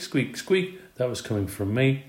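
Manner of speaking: ordinary delivery, nothing marked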